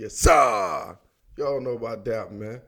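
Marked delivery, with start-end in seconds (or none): 0.0-1.0 from the stomach. Shown as a demonstration. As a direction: push a long "sir" out from the gut. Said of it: "You gotta say with your".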